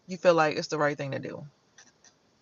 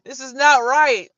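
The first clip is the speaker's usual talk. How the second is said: louder, higher, slowing pleading cry; upset protest; 8 semitones higher, 11 dB louder, slower than usual. Retold or imitated. Woman protesting to the man who scared her, loud and pleading. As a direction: protest like you were scared into it. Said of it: "I was like".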